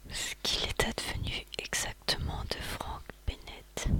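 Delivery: usual speaking voice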